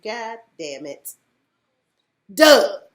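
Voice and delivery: slow curse then loud Doug; mock exasperation at Doug; exasperated. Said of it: "I said".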